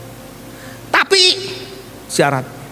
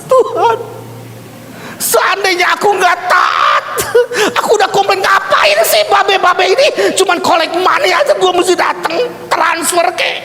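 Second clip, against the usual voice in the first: hammed-up complaint; 12 semitones higher than usual. Preacher imagining his complaint had he not obeyed, exasperated. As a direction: whine in protest, pitched way up.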